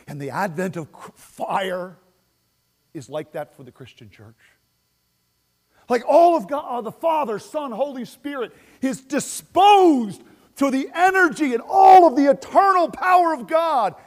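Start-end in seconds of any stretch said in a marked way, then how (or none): 5.9-13.9 full-force shout, higher and louder; shouting preacher. Real, not acted. Pastor crying out to his church, shouting.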